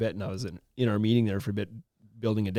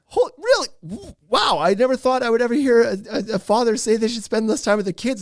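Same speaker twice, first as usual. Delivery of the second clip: much higher and louder, incredulous; astonished host; 10 semitones higher, 4 dB louder than usual. Retold or imitated. Host stunned by what the guest said, high and loud. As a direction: gasp it out like you can't believe it. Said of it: "the host is just like".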